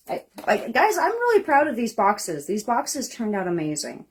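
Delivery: ordinary talk, nothing marked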